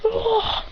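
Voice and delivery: high short cry; battle cry. Acted. Kicking Hit in the face, high cry. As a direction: bark out a kick yell. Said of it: "He goes".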